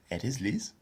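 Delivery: "¿Eres Liz?" with a clear rise on Liz; "Liz" swept upward at the end of "¿Eres Liz?"; intonation goes up. Shown as a demonstration. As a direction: demonstrate "¿Eres Liz?" as a question, lifting the last word. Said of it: "'cause the intonation goes up".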